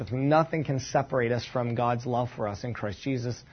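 normal talking voice

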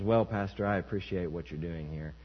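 soft, low, mild polite refusal; lightly voiced man, back to himself; ordinary man's voice. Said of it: "it's back in [name]'s voice".

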